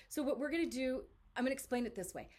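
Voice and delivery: ordinary delivery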